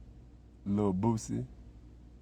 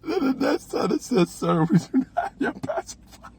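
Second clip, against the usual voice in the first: laughing through it; 4 dB louder than usual. Acted. Aide voice, teller laughing.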